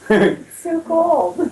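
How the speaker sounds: slow, stretched complaint; drawn out whine. Real, not acted. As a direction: stretch every word like you're freezing. Complaining about Vegas cold.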